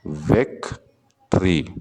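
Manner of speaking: Victory said slowly, syllables apart; slow syllable demo. Shown as a demonstration. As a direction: break victory into its beats. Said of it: "victory में दो syllable". ("Victory" धीरे-धीरे, syllable अलग)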